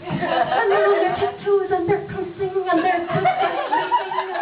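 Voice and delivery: high, light mock prancer delivery; giggly high imitation of prancers; prancing tiptoe runners. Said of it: "I call them the prancers".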